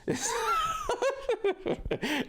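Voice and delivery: squealing high laugh; high-pitched laugh. Real, not acted. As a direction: burst into a shrill giggle.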